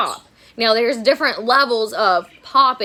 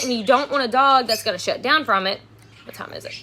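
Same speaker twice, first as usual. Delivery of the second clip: ordinary delivery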